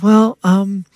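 high, stalling "well, um"; imitated sheepish opener; hesitant secretary. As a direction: stall before an awkward confession. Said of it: "She goes".

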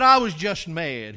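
retelling the man's words in a burst of volume; sudden loud emphasis. Retold or imitated. Man confessing his anger to the pastor, said loud and slow. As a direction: punch "I was just mad" out loud and slow. Retold confession, much louder.

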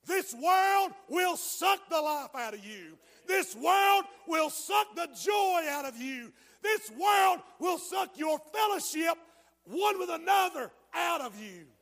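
high, loud, slow hammering sermon; shouted warning sermon; preaching at full force. Acted. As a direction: pound each line at the congregation.

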